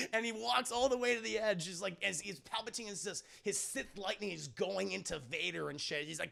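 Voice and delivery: usual speaking voice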